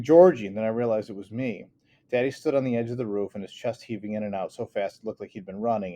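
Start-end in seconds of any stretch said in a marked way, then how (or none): 0.0-0.5 louder, raw scream of his name. A narrator's reading. Sister screaming as brother is swept away, raw scream. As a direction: scream for your drowning brother. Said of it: "Someone was screaming".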